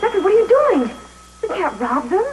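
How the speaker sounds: high, loud, alarmed challenge; shocked protest. Acted. Girl catches her friend taking money, shocked protest. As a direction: catch him in the act, voice leaping up.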